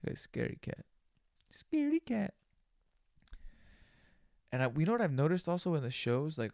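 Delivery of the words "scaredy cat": high put-on teasing squeak. Teasing the scared cousin, high squeaky voice. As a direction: squeak it like a taunt.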